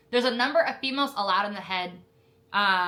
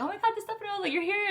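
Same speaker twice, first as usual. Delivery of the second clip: excited greeting; 12 semitones higher, faster than usual. Retold or imitated. Candidate spots her friend on first day, excited greeting. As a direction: greet a friend you never expected to see, voice way up. Retold surprise reunion with friend.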